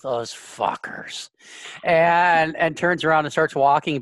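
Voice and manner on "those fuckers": slow, whispered curse; exasperated mutter. Acted. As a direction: breathe it out, fed up. Exasperated Sidetrack under breath.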